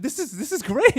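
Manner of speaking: high-pitched, eager repeat; excited kid. Retold or imitated. Kid hearing mom praise the party, excited. Child reacts to party news.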